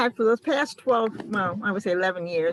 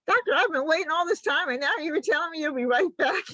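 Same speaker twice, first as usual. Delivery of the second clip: tearful pleading; 8 semitones higher than usual. Retold or imitated. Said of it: "And I'm like".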